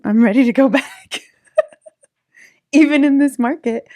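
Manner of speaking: can't wait to get back in; excited. Real, not acted. Excited about returning to real estate.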